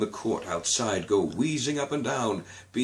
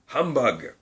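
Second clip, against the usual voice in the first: scornful humbug; 5 semitones higher, 4 dB louder than usual. A narrator's reading. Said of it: "followed it up with".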